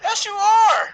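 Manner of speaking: loud, very high-pitched retort; emphatic contradiction. Real, not acted. Arguing back at the co-host who denies being Southern, loud high contradiction. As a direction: shout back in playful insistence.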